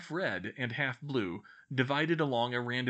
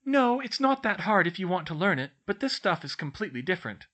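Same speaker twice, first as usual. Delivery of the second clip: put-on high, louder studious girl voice; bookish girl explaining; 8 semitones higher, 5 dB louder than usual. A narrator's reading. Girl explains her maths book to friends, earnest.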